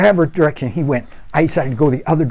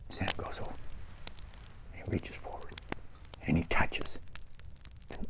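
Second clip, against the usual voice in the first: hushed suspense; 6 semitones lower, 13 dB quieter than usual. A narrator's reading. Storyteller whispering as the boys creep toward the thing. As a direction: hold your breath and whisper.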